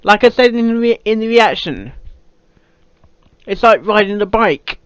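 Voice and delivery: ordinary delivery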